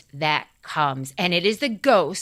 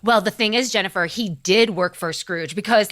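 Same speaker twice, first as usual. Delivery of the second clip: ordinary delivery, nothing marked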